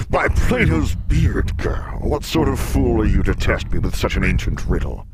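loud, booming monster mockery; bellowing beast, amused contempt; scornful sphinx. A narrator's reading. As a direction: scoff at the girl, big and theatrical. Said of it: "roared with laughter".

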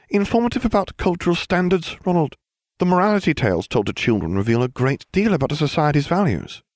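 high, know-it-all schoolgirl voice; prim Hermione. Acted. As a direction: lecture him like the cleverest witch in class. Hermione lecturing Ron, high voice.

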